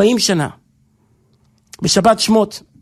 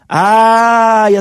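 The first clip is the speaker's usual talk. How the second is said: long drawn-out lament; much slower than usual. Acted.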